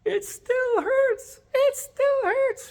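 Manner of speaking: high, loud, slow wailing scream; screaming lady. Retold or imitated. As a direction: wail it like a delirious patient. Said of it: "and she's screaming".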